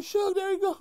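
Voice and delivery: pitched far up, imitating an eager little man; very high, hammed-up impression; mock Cat voice. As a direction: squeak it out like the rival sucking up. Mimicking Cat greeting Suge.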